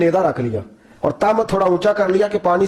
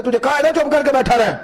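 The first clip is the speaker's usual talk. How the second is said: majestic anger; 7 semitones higher than usual. Acted. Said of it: "جلال سے دیکھا".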